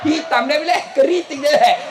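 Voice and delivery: ordinary talk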